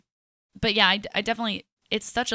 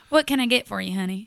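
Southern accent; 5 dB louder than usual. Shown as a demonstration. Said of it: "versus a Southern".

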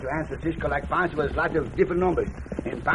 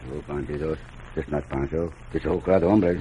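much lower and softer, hushed; hushed crowd voice; 12 semitones lower, 5 dB quieter than usual. Acted. Pancho hushes his crowd voice as bandits near, low and soft. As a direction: hush it down so the bandits won't hear.

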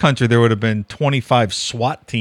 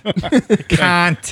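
Australian pronunciation; 5 semitones higher than usual. Shown as a demonstration. Cohost demonstrating the word, Australian accent. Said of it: "how do they say it".

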